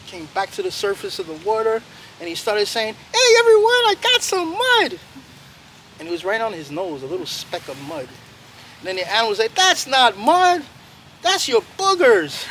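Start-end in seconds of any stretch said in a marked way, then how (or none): 3.1-5.0 high, loud call, slowing on the news; small animal shouting its victory; triumphant little muskrat. Acted. 9.5-12.5 higher, slow mocking jab; teasing animal. Animal teases muskrat.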